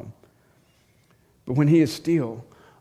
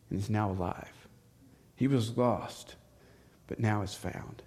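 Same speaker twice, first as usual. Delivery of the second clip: tender father; 5 dB quieter than usual. Acted. Father answers gently.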